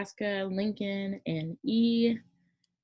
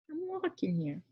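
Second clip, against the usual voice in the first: put-on quick New York accent bit, pitched higher; 9 semitones higher than usual. Explaining East Coast states, pops into a New York accent. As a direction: toss off a classic New York "I'm walking here" in a New York accent. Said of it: "You know that accent".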